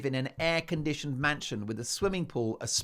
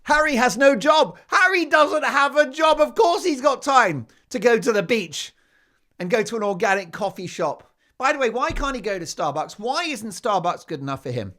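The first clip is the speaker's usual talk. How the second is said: much higher and louder, shouting it out in outrage; indignant shouting rant; 8 semitones higher, 10 dB louder than usual. Real, not acted. Host railing at Harry for having no job, shouting indignantly. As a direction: bellow it like a fed-up pundit.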